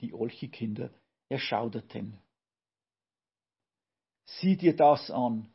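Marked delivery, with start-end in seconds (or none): 4.2-5.5 louder, higher, drawn-out call. A narrator's reading. Olchi child points out the cleaning witches, calling out. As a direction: call it out slowly, as narrated alarm. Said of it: "rief das eine Olchi-Kind".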